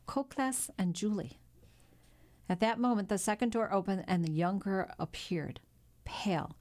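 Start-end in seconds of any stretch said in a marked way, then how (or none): none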